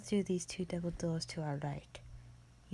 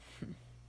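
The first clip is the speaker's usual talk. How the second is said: short, soft, breathy scoff; scoffing laugh; 9 dB quieter than usual. Acted. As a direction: let out a quiet, dismissive huff of laughter. Creature scoffs at the human visitor.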